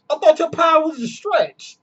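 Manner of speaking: very high, thrown-off imitation; mock incredulity. Sparrow questioning Luffy's power, high mock incredulity. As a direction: protest in a high, confused voice.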